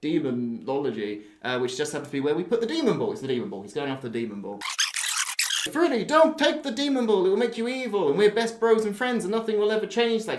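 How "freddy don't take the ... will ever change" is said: high, loud hammed-up plea; pleading brother. Brother begging Freddy not to take the ball, high hammed-up plea. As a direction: beg him not to take it, over the top.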